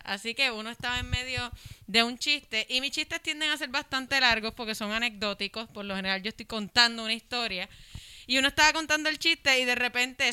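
ordinary talk, nothing marked

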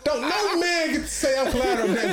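high, laughing defiance; defiant. Real, not acted. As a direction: laugh it off but stand firm.

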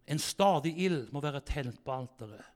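ordinary talk

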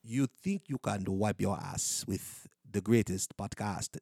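put-on slow, low, botched French accent. Host trying an accent on request, shaky attempt at French.

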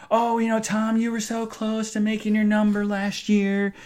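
high, bright mock boss voice, a bit over the top; mimicking the boss. Boss at the work dinner telling Tom, in a high put-on voice. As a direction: put on the boss's syrupy high tone.

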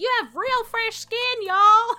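high, loud mock shout across the cabin; retold screaming. Flight attendant greeting passengers with a mock loud shout. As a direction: belt it like she has no indoor voice. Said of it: "she's screaming the whole time".

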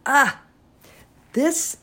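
bright delighted ah; light up at finding it; excited gasp. Real, not acted. Finds a treasured ornament.

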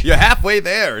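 shouted cheer. Retold or imitated. Cheerful call to Krillin.

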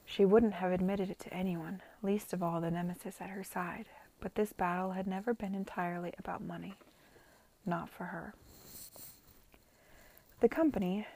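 ordinary delivery, nothing marked

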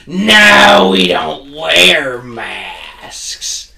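loud, high, drawn-out customer impression; mock defiant yell. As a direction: stretch each word in hammed-up indignation. Acting defiant customer refusing masks.

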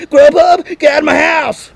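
high, loud mock yell; mock shouting back. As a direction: shout him out of the house. Shouting back at delivery guy.

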